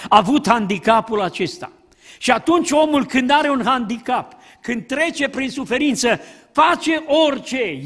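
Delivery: usual speaking voice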